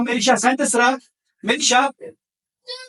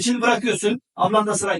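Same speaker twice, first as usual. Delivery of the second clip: ordinary talk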